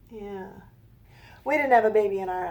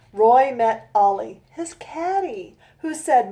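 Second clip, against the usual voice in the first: slow, rhythmic rhyming read-aloud; storybook reading; 7 dB louder, slower than usual. A narrator's reading. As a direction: read each rhyme out slowly to a child. Reads her rhyming picture book.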